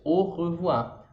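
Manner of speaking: very slow au revoir, au said as O; French au revoir. Shown as a demonstration. Teacher modelling au revoir as her example, very slow. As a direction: au revoir drawn out with a throaty R. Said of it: "vai fazer sentido aquele au revoir".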